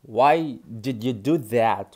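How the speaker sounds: slow, word by word; slow teaching. Shown as a demonstration. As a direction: model it slowly.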